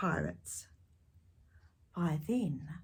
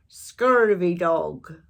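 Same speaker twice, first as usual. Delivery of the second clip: very slow, loud pirate growl; drawn-out pirate insult; pirate talk; 9 dB louder, much slower than usual. Shown as a demonstration. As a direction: savour the insult like a sea dog. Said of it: "to say real stuff like".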